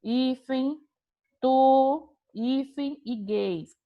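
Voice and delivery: "pó", "tu", "gays" set apart by pauses around each "hífen"; syllable by syllable. Shown as a demonstration. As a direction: dictate the pieces "pó... hífen... tu... hífen e gays" one at a time. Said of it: "se a gente fosse separar a sílaba". (sílaba por sílaba, com pausas)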